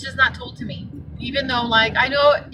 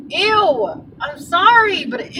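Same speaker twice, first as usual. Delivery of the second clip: higher, grossed-out ew; disgusted; 6 semitones higher, 4 dB louder, slower than usual. Real, not acted. Grossed out by a driver's comment, higher and disgusted. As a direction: recoil from something gross. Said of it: "disgust at the driver".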